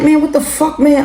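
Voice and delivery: high, exasperated disbelief; high baffled outcry. Retold or imitated. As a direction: throw up your hands. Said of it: "I'm like".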